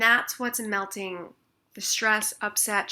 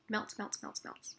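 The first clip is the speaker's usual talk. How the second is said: softer each time, trailing into a whisper; fading away; 11 dB quieter, slower than usual. Shown as a demonstration. Showing upset melting, fading into a whisper. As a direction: let the word melt into silence. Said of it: "it's like you're putting a warm blanket on a snowball".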